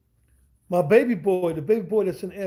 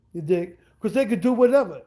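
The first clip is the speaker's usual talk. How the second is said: normal talking voice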